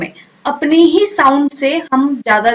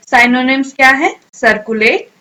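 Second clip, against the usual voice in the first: ordinary talk, nothing marked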